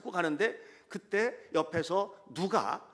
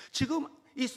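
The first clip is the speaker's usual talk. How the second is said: normal talking voice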